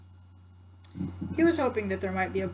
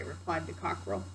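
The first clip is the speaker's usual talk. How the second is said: plain reading voice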